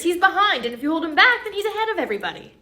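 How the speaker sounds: loud know-it-all teacher; insistent acted teacher. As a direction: smugly raise the voice to insist.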